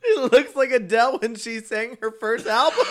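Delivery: loud, high, laughing words; cracking up. Real, not acted. Reacting to the singer's child photo, cracking up. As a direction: blurt it out through laughter.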